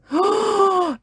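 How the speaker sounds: high sharp gasp at cold water. A narrator's reading. Mummy gasps diving into the cold sea, sharp gasp. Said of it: "She gasped".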